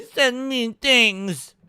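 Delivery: slow, put-on pouty complaint; mock whining. Mocking the regulator's demand for user information, slow mock whining. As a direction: whine it like a wounded tattletale.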